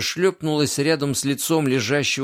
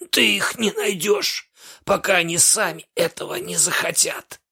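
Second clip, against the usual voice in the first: hoarse wheeze; 4 semitones higher than usual. A narrator's reading. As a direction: rasp it out after being choked. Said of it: "прохрипел".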